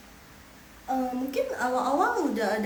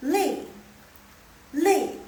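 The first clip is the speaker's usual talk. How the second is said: "lei" high and loud, falling sharply; fourth tone; 6 semitones higher, 7 dB louder than usual. Shown as a demonstration. Teacher adds fourth tone, falling lei. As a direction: drop "lei" from top to bottom. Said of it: "ditambah dengan nada keempat".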